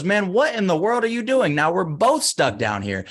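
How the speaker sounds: high-pitched, incredulous outburst; acted dismay, pitch soaring then slowing on the complaint; exasperated disbelief. Stuck man to the friend who jumped in, exasperated disbelief.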